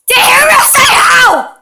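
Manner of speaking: high, loud indignant shout. Real, not acted. Kid yells at the game villager, high indignant shout. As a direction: yell it at the villager.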